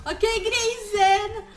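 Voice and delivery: tense call. Real, not acted. Spotting the pig, tense.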